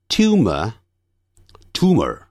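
first tumor "tyoo-muh", second "too-mer"; slow contrast of "tumor", glide then none; British, then American. Shown as a demonstration. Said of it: "再把这几组字念一下". (先英式后美式念tumor)

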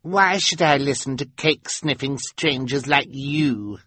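put-on high bratty girl voice; bratty schoolgirl taunt; snotty Carmelita. A narrator's reading. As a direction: sneer at the strangers. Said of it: "she asked".

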